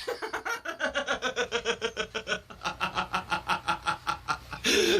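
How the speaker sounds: long wheezing laugh, trailing off; helpless laughter. Real, not acted. Storyteller after the boy's tantrum, helpless laughter. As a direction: lose it after the outburst. Cracking up after acted shout.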